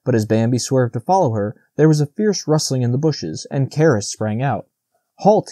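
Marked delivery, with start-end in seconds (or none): 5.2-5.5 sharp cry of "Halt!", pitched well up; high, urgent challenge; cried command. A narrator's reading. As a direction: cry out a halt to stop someone. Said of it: "he cried".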